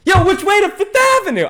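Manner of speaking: loud, high holler imitating a passerby; retold yell. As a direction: yell it out across the street, hammed up. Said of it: "he yells out".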